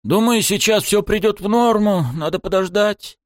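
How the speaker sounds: lying reassurance. A narrator's reading. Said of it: "Я решил просто соврать".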